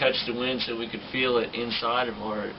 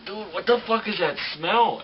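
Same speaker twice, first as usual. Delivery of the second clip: higher, disgusted mock voice of a just-woken guy; groggy complaint; 8 semitones higher than usual. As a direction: imitate the buddy sniffing the air in disgust. Old guy wakes up, smells lip.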